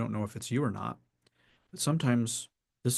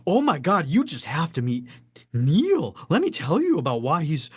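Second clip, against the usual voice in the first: higher, much louder overexcited praise; gushing introduction; 5 semitones higher, 7 dB louder than usual. Shown as a demonstration. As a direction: rave about Neil like a hype fan.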